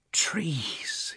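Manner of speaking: breathless awed whisper; whispered exclamation. A narrator's reading. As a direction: gasp it out in a whisper. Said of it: "he exclaimed".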